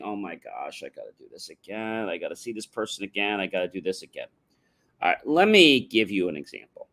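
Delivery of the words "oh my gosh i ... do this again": quiet, drained grumbling; weary groan. Retold or imitated. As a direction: moan it like a chore.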